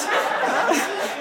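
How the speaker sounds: quick high giggle; short real laugh. Real, not acted. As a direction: let a giggle slip out. Laughs mid-sentence. (kort giechelen)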